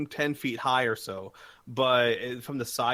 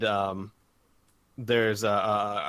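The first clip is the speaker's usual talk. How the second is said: ordinary delivery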